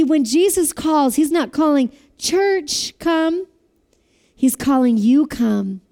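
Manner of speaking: ordinary talk, nothing marked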